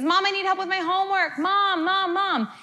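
put-on high whiny child calling Mom; mock child nagging for mom; imitating child calling. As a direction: call out like a needy kid.

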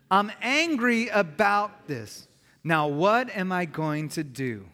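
slow, drawn-out "I'm angry about this", each word given room; leading in unison. Shown as a demonstration. Preacher leads congregation saying it out loud, slow and emphatic. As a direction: say it out loud slowly and deliberately for a group to repeat. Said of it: "Can you say that out loud with me?".

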